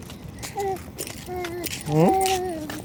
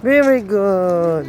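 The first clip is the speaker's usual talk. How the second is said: slow, drawn-out praise with falling tune; long sing-song cheer; much slower than usual. Real, not acted. Praising toddler.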